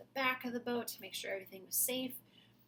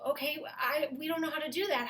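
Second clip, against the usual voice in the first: worried monster; 6 semitones higher, 6 dB louder, faster than usual. Acted. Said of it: "And the monster said".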